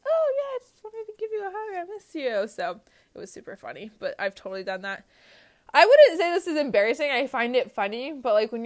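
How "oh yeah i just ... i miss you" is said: very high-pitched mock sweetness; acted awkward cover-up. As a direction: squeak out a sheepish excuse to a stranger. Acted cover-up to mistaken stranger.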